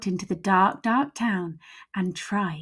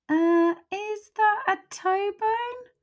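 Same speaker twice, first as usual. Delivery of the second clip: much higher, slow puzzled voice; little skeleton; 13 semitones higher, slower than usual. Acted. Little skeleton puzzles over a bone, high and slow. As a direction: squeak it out, unsure.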